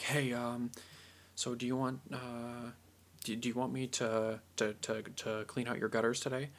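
soft, halting, hesitant offer; not very confident. Acted. Offering to clean someone's gutters, unconfident and hesitant. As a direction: stumble timidly through the request. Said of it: "doesn't seem very confident".

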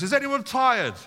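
preacher imagines calling out to a crowd, hammed-up; mock public call. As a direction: call out to the room like a street announcer. Mock call to new parents.

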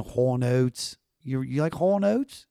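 rolled Maryland O's, rising into a question; Baltimore accent. Shown as a demonstration. Musician demonstrating rolled O's, thick Baltimore accent. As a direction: show off a broad Baltimore twang. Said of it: "roll my O's".

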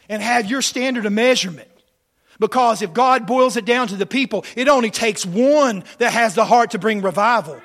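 very loud, driving revival preaching; full-force preaching. Acted. Preacher challenging the church on revival, full-force preaching. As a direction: belt it out to stir the congregation.

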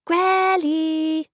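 high, loud call through the door. Acted. Impatient girl calling grandma through the door, loud. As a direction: yell the name up at the house.

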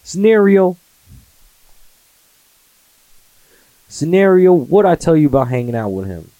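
high, loud scolding dad voice; stern parent voice. Pretend. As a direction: snap at your son like a strict father. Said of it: "when I start doing that voice to my son".

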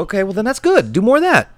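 high, rising excited praise. Acted. Player cheering the Shaman on, high and excited. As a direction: urge him on, thrilled.

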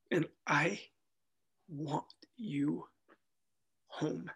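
a hint of a loving God, easing into a whisper; gentle loving voice. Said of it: "he told me".

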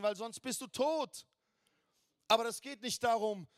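high, loud preaching to the congregation; full-force preaching. Acted. Preacher rallies the congregation about the good news, high and loud. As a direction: rouse the church with the good news.

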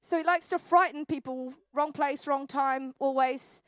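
ordinary delivery

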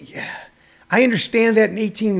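usual speaking voice

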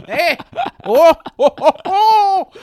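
high, loud "oh-oh" laughing; mock guffaw. Comic laughs at own village bicycle joke, high hooting. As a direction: hoot at your own joke, pitch climbing.

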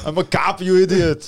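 high, loud action-hero outburst; exasperated action-star yell; Arnold. Acted. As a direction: shout it in a thick Austrian movie accent. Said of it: "Can you do Arnold?".

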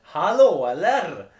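high, loud Gothenburg "Hallå, eller?"; hammed-up Gothenburg accent; Gothenburg greeting. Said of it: "Är inte det göteborgska?".

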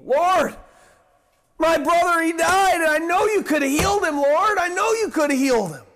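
high, wailing mock lament to the Lord; acted grieving plea. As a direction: plead it out, pitched high and pressing. Acting out grieving Mary's plea.

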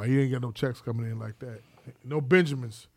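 usual speaking voice

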